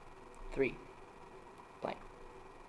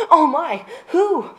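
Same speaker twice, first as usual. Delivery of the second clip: mock gasp; 12 dB louder, much slower than usual. Gasp at the news.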